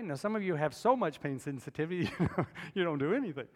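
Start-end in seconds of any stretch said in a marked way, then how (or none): none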